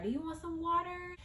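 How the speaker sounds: nurse voice. Retold or imitated. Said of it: "she was just like".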